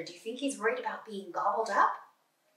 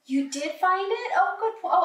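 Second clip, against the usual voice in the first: higher, louder, delighted; excited praise; 8 semitones higher, 6 dB louder than usual. Real, not acted. Teacher thrilled a child found the caterpillar, excited. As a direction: burst out with delight at the find.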